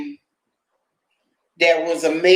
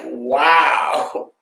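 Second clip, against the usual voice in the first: disbelief; slower than usual. Retold or imitated. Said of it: "I was like".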